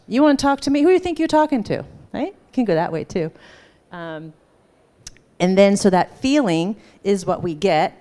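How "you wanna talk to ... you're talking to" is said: higher, fast, bristling comeback; mock-offended challenge to the one who wants to talk; mock indignant. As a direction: puff up like you've been insulted. Voicing a pissed feeling.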